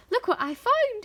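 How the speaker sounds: high, loud delighted announcement; excited boy. A narrator's reading. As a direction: show off a find with excitement. Jack proudly shows the hen.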